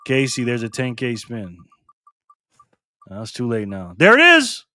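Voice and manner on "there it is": high, loud exclamation; excited call. Real, not acted. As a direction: burst out with it at the reveal. Reacting to the spin result.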